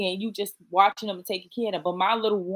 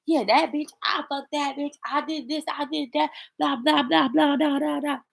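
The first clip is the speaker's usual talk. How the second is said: higher-pitched mock bragging; brother on the phone; 7 semitones higher than usual. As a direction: rattle off a mocking version of street talk. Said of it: "my brother could come in from out the street on the phone".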